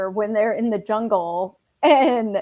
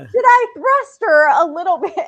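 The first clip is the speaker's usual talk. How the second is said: laughing through a high, loud quote; 6 semitones higher, 6 dB louder than usual. Retold or imitated. Friend quoting Jason through laughter, high and loud. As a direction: giggle the words out while quoting.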